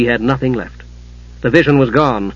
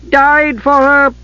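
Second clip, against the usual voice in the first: high, drawn-out incredulous scoff; mocking, amused; 13 semitones higher, 3 dB louder, much slower than usual. A narrator's reading. Mother laughing at her son's loyalty, mocking. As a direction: scoff slowly in a very high pitch. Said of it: "Kitiara laughed".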